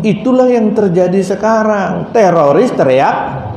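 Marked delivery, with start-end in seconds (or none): none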